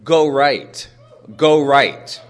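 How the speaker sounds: a stranger directing loudly on the stairs; retold insistent command. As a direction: call out the direction firmly. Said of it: "I hear this woman say".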